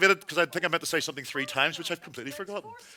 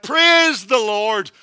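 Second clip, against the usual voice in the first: slow, loud praise; joyful relief; 2 semitones higher, 6 dB louder, much slower than usual. Retold or imitated. Relieved passenger after landing, shouting praise. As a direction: shout thanks to heaven.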